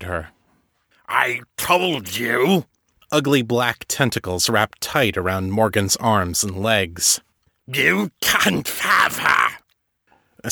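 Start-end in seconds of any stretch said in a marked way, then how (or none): 1.1-2.7 slow menacing villain. Acted. Ezekiel grabbing Morgan. 7.7-9.6 slow snarling villain. Acted. Ezekiel grabbing Morgan.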